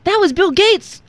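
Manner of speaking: high, loud outcry; Lucifer. A narrator's reading. Demon protesting to Satan, crying out. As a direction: cry out in a high-pitched protest. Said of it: "cried Lucifer".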